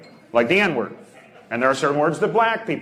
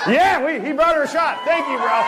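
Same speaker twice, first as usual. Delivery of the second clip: celebrating that the drink came; excited; 10 semitones higher than usual. Real, not acted. Cheering the shot arriving.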